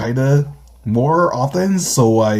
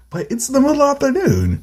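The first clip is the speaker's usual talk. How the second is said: baffled correction. Acted. Baffled reply to the greeting.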